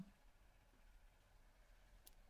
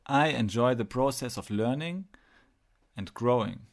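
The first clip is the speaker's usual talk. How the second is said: louder, assured affirmation; leading affirmation; 5 dB louder than usual. Shown as a demonstration. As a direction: state it clearly for others to repeat. Repeat the following affirmations to yourself.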